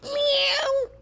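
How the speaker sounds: very high falsetto meow; squealed cat cry. Retold or imitated. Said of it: "says the cat".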